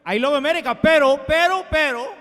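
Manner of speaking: a hint of an old Cuban exile with a Cuban accent, Spanish "pero" rolled. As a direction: nostalgic old Cuban man with a light Cuban accent. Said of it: "Number two".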